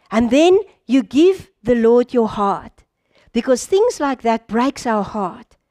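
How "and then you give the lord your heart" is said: ordinary talk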